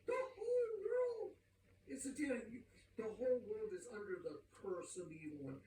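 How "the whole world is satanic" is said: very high, straining exclamation; exasperated outburst. Acted. Preacher declaring the whole world satanic, high exasperated cry. Mock outburst over the revelation.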